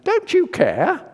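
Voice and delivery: high, loud, slow indignant cry; indignant outburst. Retold or imitated. Martha rounding on Jesus, high loud indignant complaint. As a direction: suddenly turn on him in complaint. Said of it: "and then".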